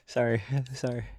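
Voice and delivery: soft giggles breaking through an apology; can't stop chuckling while saying sorry; real helpless giggling; laughing. Real, not acted. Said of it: "I don't know why I'm laughing".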